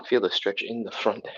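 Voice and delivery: usual speaking voice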